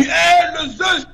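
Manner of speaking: imitated street call from excited policemen, pitched high; policiers qui crient. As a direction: call out Arafat's name like cops hailing a star. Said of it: "C'est les policiers qui crient dans la rue". (imitation de policiers qui interpellent dans la rue)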